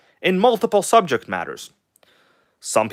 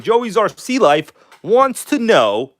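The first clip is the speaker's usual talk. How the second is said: higher, slow, stretched-out showman voice; playful hype voice, dragged out and higher; fun put-on voice; 8 semitones higher, 3 dB louder, slower than usual. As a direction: drag the name out like a goofy host. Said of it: "why do I keep talking like this?".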